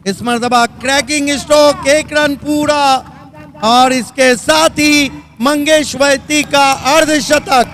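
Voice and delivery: loud, lifted celebration call; excited cricket commentary. Real, not acted. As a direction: celebrate the fifty. Calls the half-century.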